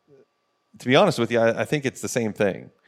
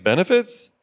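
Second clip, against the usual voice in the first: skeptical rising "Benefits?"; incredulous; 8 semitones higher than usual. Retold or imitated. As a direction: ask "Benefits?" in disbelief, sharp upward rise. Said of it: "some people are like".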